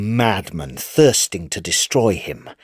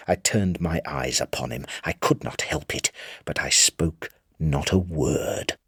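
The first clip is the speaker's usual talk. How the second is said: hushed menace; 4 semitones lower, 7 dB quieter than usual. A narrator's reading. Madman's silent menacing gaze.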